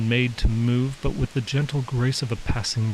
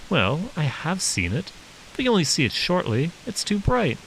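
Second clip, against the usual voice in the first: higher, lightly voiced young boy explaining; a small boy earnestly reasoning; voiced little boy; 7 semitones higher than usual. Little boy telling the giant the sun is too bright, lightly voiced child.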